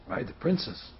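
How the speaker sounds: hushed, low echo in disbelief; shocked. Acted. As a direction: repeat it very softly, stunned. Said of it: "He's shocked".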